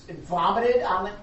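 acted scornful disbelief; mocking lawyer. Lawyer questioning girl on the fish, mocking. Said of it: "just mocking her".

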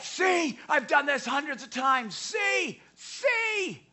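very high mock shouting, triumphant taunt; imitated high-pitched defiant yells. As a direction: act out a man yelling his proof. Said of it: "he starts shouting".

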